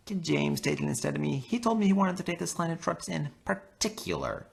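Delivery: high, eager little engine; high small engine voice. Acted. Percy pleads to swap.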